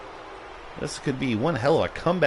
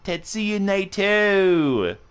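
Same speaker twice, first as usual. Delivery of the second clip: announcer-style name call; 8 semitones higher, much slower than usual. Pretend. Streamer teasing Rich with a wrestler's name, theatrical call. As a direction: stretch it out like a ring introduction.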